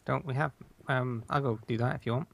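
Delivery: ordinary talk, nothing marked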